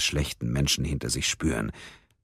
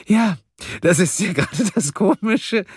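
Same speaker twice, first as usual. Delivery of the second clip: high, louder giggling voice; 5 dB louder than usual. Acted. Karen giggling to Mia about the bomb house, high giggle. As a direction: giggle it out gleefully. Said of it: "kicherte Karen".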